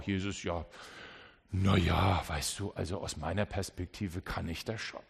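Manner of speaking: low, offhand shrug; a listener shrugging off Jesus; dismissive. Pretend. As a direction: brush him off, unconcerned.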